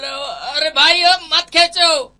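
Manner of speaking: high, alarmed stammering yelp; panicked outcry. Acted. Trainee begging instructor not to pull the grenade ring, panicked. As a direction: cry out to stop him in fright.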